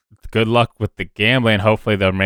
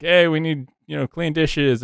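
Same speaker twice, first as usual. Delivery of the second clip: half-hearted imitation of a yell; mock yell; 7 semitones higher than usual. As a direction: call out lightly, as if from the kitchen. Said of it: "somebody probably yells and goes like".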